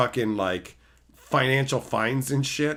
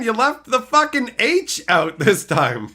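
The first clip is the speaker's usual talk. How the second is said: high, loud mock telling-off; mock scolding; 11 semitones higher, 7 dB louder than usual. Mock scolding chat for misspelling.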